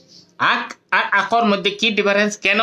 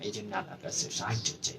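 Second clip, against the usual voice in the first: whispered prayer; 6 semitones lower, 18 dB quieter, faster than usual. Real, not acted. Quiet prayerful aside.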